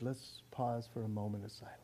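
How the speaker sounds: lightly voiced announcer calling for silence; hushed announcer. Preacher imitating an announcer calling a moment of silence, hushed. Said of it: "once the announcer says".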